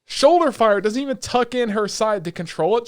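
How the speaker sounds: loud, heated rant about the scene; loud angry rant. Real, not acted. Podcaster ranting to cohost about the helicopter scene, loud and heated. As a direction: vent hard and fast, fired up. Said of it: "I need to bitch".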